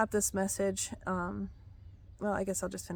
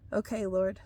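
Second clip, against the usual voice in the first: normal talking voice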